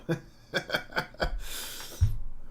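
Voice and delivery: loud open laugh, breathy and high; hearty laugh. Real, not acted. Streamer laughing at a viewer's remark, loud open laugh. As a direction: burst out laughing at the comment.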